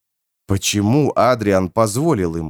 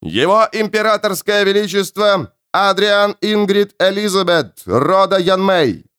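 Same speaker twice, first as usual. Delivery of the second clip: town crier; 11 semitones higher than usual. A narrator's reading. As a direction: proclaim like a royal herald. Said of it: "Зычный голос Герольда прогремел".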